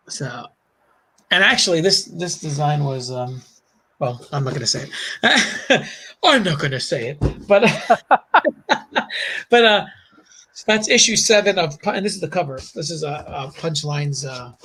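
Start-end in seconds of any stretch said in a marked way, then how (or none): 4.0-11.2 laughing. Real, not acted. Teasing, holding back a secret.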